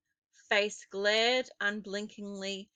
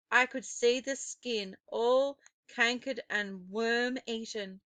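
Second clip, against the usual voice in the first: ordinary delivery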